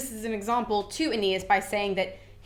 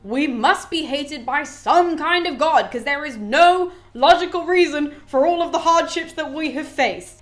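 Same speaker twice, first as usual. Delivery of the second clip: mock despair; 5 semitones higher, 5 dB louder than usual. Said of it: "basically the sentiment".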